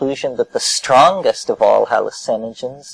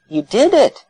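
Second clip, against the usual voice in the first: telling him plainly; 12 semitones higher, 3 dB louder than usual. Retold or imitated. Answering the dazed person.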